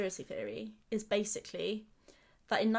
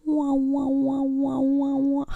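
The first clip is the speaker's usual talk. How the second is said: high, loud wavering alien warble; 4 semitones higher, 9 dB louder, slower than usual. Pretend. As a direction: belt the alien noise out. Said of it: "let me segue into it".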